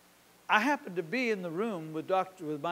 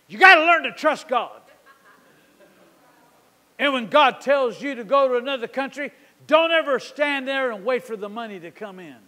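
loud, high preacher's rebuke, easing toward the end; Lester Summerall; 4 semitones higher, 9 dB louder than usual. Retold or imitated. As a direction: thunder it at the congregation, then ease off. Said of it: "getting rebuked by Lester Summerall".

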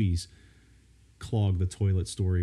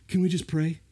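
put-on higher-pitched child voice, begging; imitated pleading kid; 7 semitones higher than usual. Kid asking dad to pray first, mock pleading. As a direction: mimic a desperate little plea.

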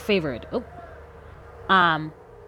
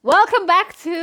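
excited intro; 11 semitones higher, 8 dB louder than usual. Acted. Hyped show intro.

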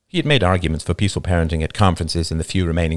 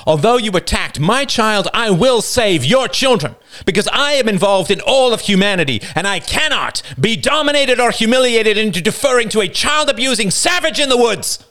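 higher, building fervent declaration; defiant climax; 13 semitones higher, 4 dB louder than usual. Acted. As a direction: rise to a passionate vow.

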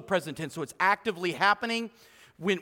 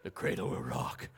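whispered, much lower, eerie lullaby line; creepy whisper lullaby; 12 dB quieter than usual. Pretend. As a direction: whisper it like a ghost at the crib. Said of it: "put a creepy voice to that".

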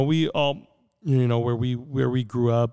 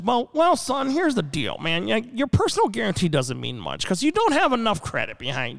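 high-pitched imitation of a banker; mock banker; 7 semitones higher than usual. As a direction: talk down to him as the banker, pitched up. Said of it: "the banker dude's looking at me and goes".

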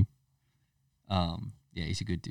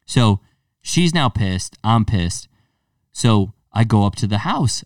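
ordinary talk, nothing marked

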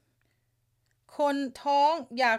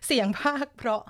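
ordinary talk, nothing marked

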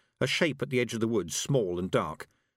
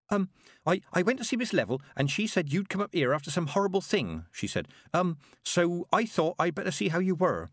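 high, hesitant and flustered; nervous young girl; 8 semitones higher than usual. A narrator's reading. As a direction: stammer it out, high and shy. Petulia voiced in story.

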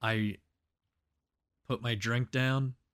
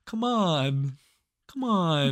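higher, louder mock exasperation; weary protest; 7 semitones higher, 6 dB louder, slower than usual. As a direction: plead like it is the third hit already. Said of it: "he was just like".

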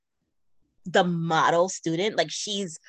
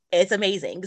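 normal talking voice

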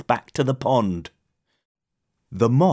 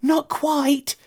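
high-pitched little moth calling; bright-spirited high moth cry; 13 semitones higher than usual. A narrator's reading. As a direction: call back in a small high voice. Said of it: "cried the moth".

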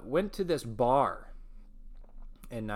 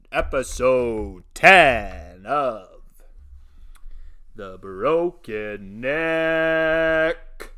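slow, booming sports-announcer welcome; announcer intro; 4 semitones higher, 9 dB louder, much slower than usual. Acted. Host opening the show to his listeners, slow booming announcer voice. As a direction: stretch every word like a stadium announcer.

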